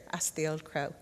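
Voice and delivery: ordinary delivery, nothing marked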